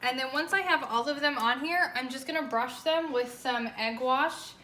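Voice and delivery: ordinary delivery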